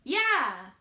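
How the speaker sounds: sudden loud blurted yeah; nervous shouted yeah; awkward yell. Retold or imitated. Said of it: "just yelled out".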